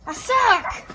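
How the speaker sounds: loud, high self-scolding yell; frustrated shout. Real, not acted.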